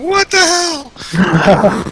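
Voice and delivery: high, drawn-out cry of disbelief; shocked disbelief. Retold or imitated. Patient hears his patella came loose, shocked. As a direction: stretch it out high in retold shock. Acted shocked reply to technician's news.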